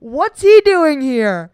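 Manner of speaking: stunned surprise; shocked. Real, not acted. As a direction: blurt out the name in disbelief. Shocked by quiz result.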